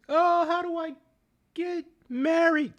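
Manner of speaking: very high, frantic mocking wail; hammed-up frantic falsetto. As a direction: shriek it like a desperate woman. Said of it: "went from frantic".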